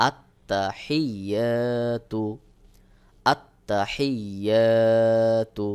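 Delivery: very slow recitation with long-held vowels; tajweed recitation. Shown as a demonstration. Teacher models the word for learners, slowly recited. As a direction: draw out the "য়া" in আত্তাহিয়াতু.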